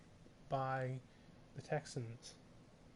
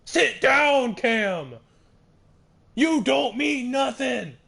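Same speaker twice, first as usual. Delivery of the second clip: screamed much higher and louder; hyped screaming; 11 semitones higher, 15 dB louder, slower than usual. Real, not acted. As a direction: scream the taunt at full power. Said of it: "I was getting a bit too hyped".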